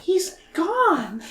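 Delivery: put-on very high girlish Mina voice. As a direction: squeak it out like a young girl.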